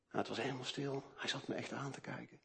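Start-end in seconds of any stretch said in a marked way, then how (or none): none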